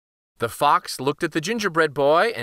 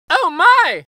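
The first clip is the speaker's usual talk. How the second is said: high, loud yelp of dismay; squealing in fright, high and loud; frightened cry; 14 semitones higher, 9 dB louder than usual. Acted. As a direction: gingerbread boy shrieking as he vanishes. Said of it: "cried the gingerbread boy".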